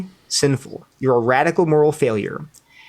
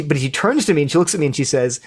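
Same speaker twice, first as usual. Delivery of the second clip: ordinary delivery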